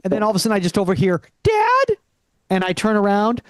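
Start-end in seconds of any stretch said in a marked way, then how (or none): 1.4-2.0 put-on terrified girl calling. Daughter calls out, terrified.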